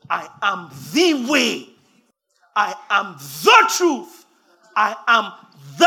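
preacher proclaiming Jesus' words, slow and loud; booming proclamation. Acted. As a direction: thunder out "I am the way" word by word. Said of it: "Jesus says".

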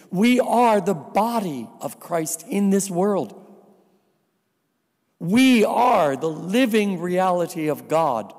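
ordinary talk

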